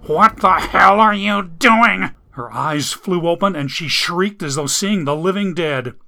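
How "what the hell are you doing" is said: raised, higher, louder and slow; wounded man furious at his girlfriend; angry demand. Acted. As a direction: bark it out in shock and anger. Jack bursts in, furious.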